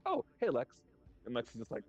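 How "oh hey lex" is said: high breezy greeting; casual Superman. Retold or imitated. Retelling the comic, Superman greets Lex, high and breezy. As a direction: chirp a cheery hello. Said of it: "he's like".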